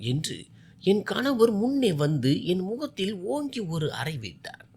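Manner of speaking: ordinary delivery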